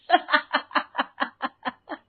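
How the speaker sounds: high staccato laughter; teasing laugh. Acted. Son teasing his superstitious mother, mocking laugh. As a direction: laugh at your own joke.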